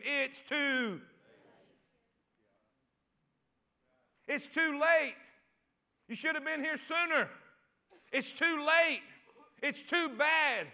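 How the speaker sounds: lightly voiced devil, stretching each verdict; devil's taunt. Preacher voicing the devil discouraging a believer, slow mock taunt. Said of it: "the devil jumped up on your shoulder".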